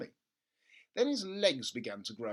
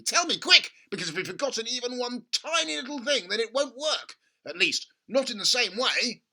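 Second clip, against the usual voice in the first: high, loud and urgent; an anxious dad, high and urgent; frantic father; 9 semitones higher, 9 dB louder than usual. Acted. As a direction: demand the answer at once. Father voiced in story.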